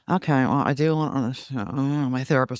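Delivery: hammed-up grudging therapy line turning mad; grudging, insincere. Shown as a demonstration. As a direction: sound forced, then let the anger slip out. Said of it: "you notice the way I'm saying it".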